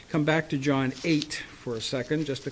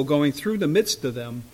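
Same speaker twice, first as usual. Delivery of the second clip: normal talking voice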